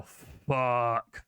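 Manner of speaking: booming dragon voice yelling; loud draconic voice. A narrator's reading. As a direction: bellow it like a dragon. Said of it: "a very loud draconic voice".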